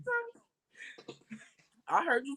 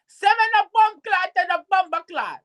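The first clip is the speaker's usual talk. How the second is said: a higher-pitched mock Jamaican accent; 11 semitones higher than usual. Shown as a demonstration. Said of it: "I think I can do a Jamaican one".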